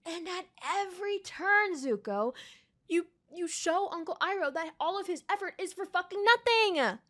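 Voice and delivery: high, heated scolding; exasperated telling-off; angry rant. Real, not acted. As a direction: hit him with the disappointment. Scolding Zuko.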